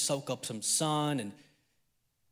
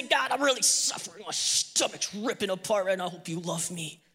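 hammed-up agony, fading away; mock suffering; 4 dB quieter than usual. Faster complains to God of hunger, mock agonised. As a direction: draw out the misery, then tail off weakly. Acts suffering while fasting.

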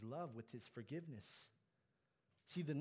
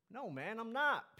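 louder, higher indignant denial; retorted; 8 semitones higher, 8 dB louder than usual. A narrator's reading. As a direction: fire back a drawn-out denial. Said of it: "Peter retorted".

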